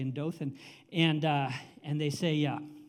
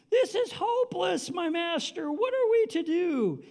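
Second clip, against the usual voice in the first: high-pitched theatrical wail; mock despair; 13 semitones higher, 4 dB louder than usual. Servant crying to his master, hammed-up despair. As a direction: plead helplessly, voice pitched way up.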